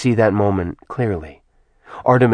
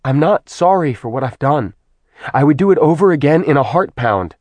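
higher, rising defiance, speeding up; defiant goddess; 5 semitones higher, 4 dB louder than usual. Acted. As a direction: refuse to apologise, proud and stubborn. Artemis voiced by narrator.

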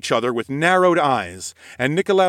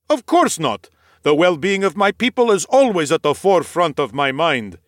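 higher, breezy knightly answer; easy noble reply; 4 semitones higher, 3 dB louder than usual. Acted. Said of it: "Nikolaus replied easily".

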